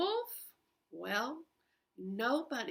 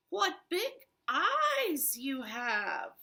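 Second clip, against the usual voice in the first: put-on slow, higher girl voice; drawn-out childlike wonder; puzzled girl; 4 semitones higher, 3 dB louder, slower than usual. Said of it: "she thought".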